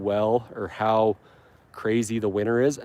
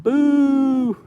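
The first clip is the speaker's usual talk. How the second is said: a much higher, slightly louder cartoon "boo"; mock boo; 6 dB louder than usual. Booing the bindweed found in his lawn, high mock boo. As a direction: boo the weed like a pantomime villain.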